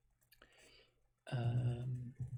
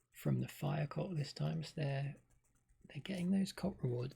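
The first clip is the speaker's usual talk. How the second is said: ordinary talk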